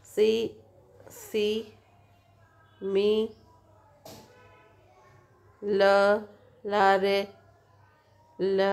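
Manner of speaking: similarly broken into slow separate syllables. Shown as a demonstration. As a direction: break similarly apart, one syllable at a time with pauses. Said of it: "has five syllable".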